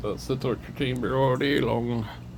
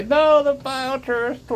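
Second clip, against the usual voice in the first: mock high retort; 5 dB louder than usual. Mock retort in a quoted exchange.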